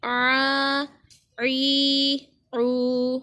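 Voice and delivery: आ, ई, ऊ each begun with a throat-squeezed ain. Shown as a demonstration. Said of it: "आइन की आवाज़ निकालनी है". (आ, ई, ऊ को आइन की आवाज़ में गले से पढ़ें)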